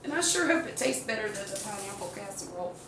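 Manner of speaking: ordinary talk, nothing marked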